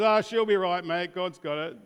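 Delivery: mock the easygoing Aussie shrug; a hint of broad Australian; Aussie she'll be right. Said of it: "that Aussie cultural view ... and says".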